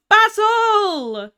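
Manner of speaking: loud, high, drawn-out name; excited call-out. Real, not acted. Streamer spotting a friend in chat, excited call-out. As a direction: shout out in excited surprise.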